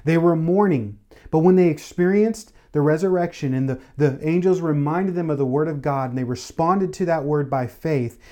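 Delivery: fervent preaching, pitch lifted, pressing the point; preaching at full force. Acted. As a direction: build the sermon to a joyful peak. Preacher builds the story.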